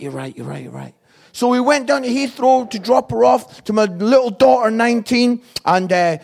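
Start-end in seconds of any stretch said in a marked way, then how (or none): 0.0-0.9 imitating a subdued wife, low and soft; meek agreement. Wife giving in to husband's no-crying rule, meek and hushed. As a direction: give in quietly, voice dropped.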